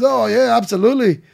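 high, loud attempt at Irish; hammed-up, clumsy Irish accent; bad Irish accent. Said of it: "fuck this bad Irish accent".

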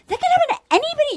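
high, fast mock panic; shaken alarm. Shaken contestants warning each other after the breakup, high mock alarm. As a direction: imitate rattled contestants. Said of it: "all the women are shook".

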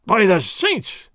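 slow, drawn-out roar of a burly sergeant; hammed-up soldier's roar. A narrator's reading. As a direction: let it rumble like thunder, slowly. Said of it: "Sergeant Gonzalez roared".

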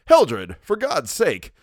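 loud, high alarmed cry of a startled cousin; frightened cry. Acted. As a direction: cry out in sudden fear.

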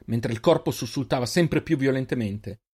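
plain reading voice

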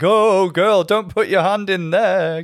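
high, loud, camp warning; camp drag queen. Retold or imitated. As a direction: camp it up, slow and dramatic. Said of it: "camp it up and be like".

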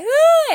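sudden high, loud frightened scream; child recalling his scared scream; retold scream. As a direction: let out a startled shriek as if hearing a crash. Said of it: "I screamed".

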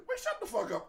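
serious hushing. Retold or imitated. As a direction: imitate viewers seriously silencing someone. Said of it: "we just serious was like".

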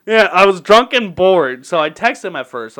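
ordinary delivery

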